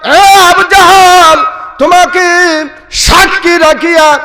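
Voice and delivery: high, ringing proclamation; impassioned preaching. Acted. Meccans defy Abu Jahl before taking the kalima, high proclamation. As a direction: declare it in a high, stretched preacher's cry.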